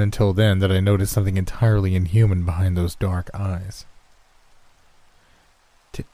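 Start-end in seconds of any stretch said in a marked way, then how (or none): 5.9-6.1 halting question, restarting the first word, pitch climbing; unsure, higher-rising question; hesitant. A narrator's reading. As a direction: ask it tentatively, stumbling on the opening. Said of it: "I asked hesitantly".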